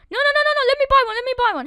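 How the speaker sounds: high, loud hurried pleading; frantic. Real, not acted. Gamer rushing to buy another bed, frantic. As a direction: scramble in panic.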